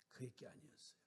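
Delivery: whispered, sombre; hushed. Acted. Preacher telling of the sister's death, whispered. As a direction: drop to a whisper for her death. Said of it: "hushed tragic ending".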